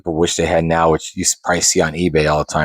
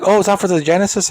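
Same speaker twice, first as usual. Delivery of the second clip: high, rising surprised question; surprised parent; 11 semitones higher, faster than usual. Retold or imitated. Parent double-checking the console with the clerk, high and surprised. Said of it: "And they're like".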